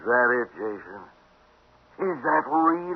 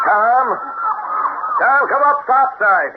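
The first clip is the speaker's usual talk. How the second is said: calling out; 12 semitones higher, slower than usual. Acted. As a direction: shout down the hatch to the crewman. Ernie calls crewman below deck.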